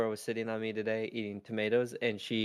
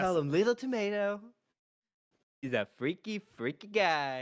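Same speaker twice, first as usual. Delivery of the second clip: higher-pitched, louder Trump impression; put-on Trump voice, drawing out the last words; Brennan Lee Mulligan's Trump; 9 semitones higher, 3 dB louder than usual. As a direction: imitate a comedian doing Trump. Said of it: "Brennan Lee Mulligan's, uh, Trump".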